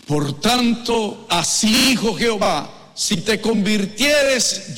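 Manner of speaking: high, loud scripture proclamation; full-voiced pulpit reading, drawing out key words; preaching. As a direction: proclaim each phrase to the congregation.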